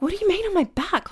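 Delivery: can't believe what they ask; incredulous. Retold or imitated. To ambulance crew, disbelieving being told to lie back. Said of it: "And I was like".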